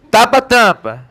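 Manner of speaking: pinch your nose and call it out; demonstrating with the nose held shut. Shown as a demonstration. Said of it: "Fechando o nariz".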